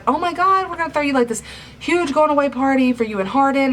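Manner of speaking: high, bubbly, over-the-top excitement; gushing Steph impression. Steph gushing to Tessa about the party, high and bubbly. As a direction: squeal it like a gossipy friend with big news.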